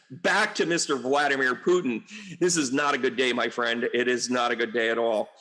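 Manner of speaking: ordinary talk, nothing marked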